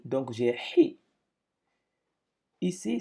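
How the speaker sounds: normal talking voice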